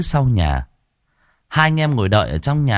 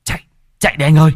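fast, higher, loud panicked yell; shouting; 6 semitones higher, 5 dB louder, much faster than usual. Acted. Năm yelling at his brother to flee the ghost, panicked shout. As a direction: scream at him to run. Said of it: "hét lên".

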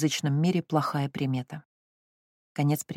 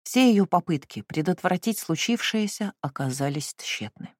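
plain reading voice

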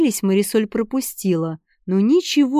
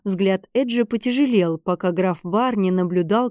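ordinary delivery, nothing marked

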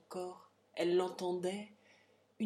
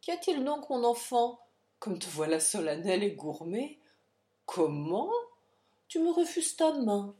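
puzzled father; 4 semitones higher, 4 dB louder than usual. Acted. Father confused by cold daughter.

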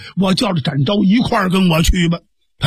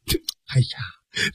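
exasperated whispered sigh; 7 semitones lower, 9 dB quieter, slower than usual. Acted. Zhan Zhao exasperated at joke.

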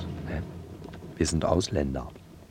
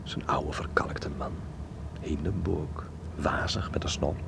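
whispered, slow and secretive; a low conspiratorial whisper; hushed whisper; 3 semitones lower, 8 dB quieter, slower than usual. Acted. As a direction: lean in and whisper the gossip. Hushed gossip in the Reichstag.

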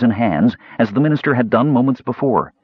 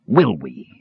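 mocking; 4 semitones lower than usual. A narrator's reading. Killer mocks dying minister.

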